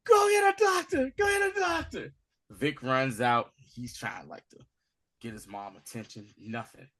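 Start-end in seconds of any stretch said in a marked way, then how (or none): 0.0-2.1 high, loud panicked shout; freaking out. Retold or imitated. Son panicking at his mother's bedside, shouting for a doctor. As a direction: yell it in panic. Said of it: "He freaks".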